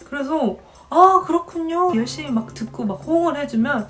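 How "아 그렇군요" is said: higher, louder eager agreement; bright polite reply. Retold or imitated. A student answering the museum guide with eager politeness. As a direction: nod along eagerly, pitched up.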